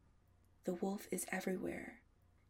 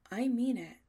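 much louder, higher, firm insistence; stern; 4 semitones higher, 10 dB louder than usual. A narrator's reading. Adam refusing Chris's advances, sternly. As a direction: lay down the law. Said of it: "Adam says sternly".

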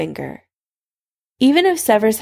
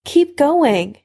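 higher-pitched, insistent order; demanding; 7 semitones higher than usual. A narrator's reading. Severus orders Harry on, demanding. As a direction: urge him on insistently. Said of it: "Severus demanded".

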